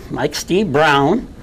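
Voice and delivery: usual speaking voice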